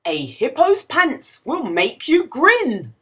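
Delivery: ordinary delivery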